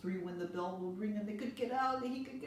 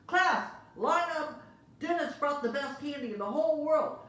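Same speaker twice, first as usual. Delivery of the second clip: excited teacher call; 10 semitones higher, 10 dB louder than usual. Acted. Teacher calls his class to line up, loud and high. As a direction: summon the class with glee.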